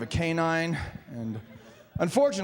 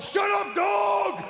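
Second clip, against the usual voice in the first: high, exasperated holler; comic high-pitched shout; yelling at dog; 13 semitones higher, much slower than usual. Acted. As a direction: yell out the window at a noisy dog. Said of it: "yell at your neighbor's dog".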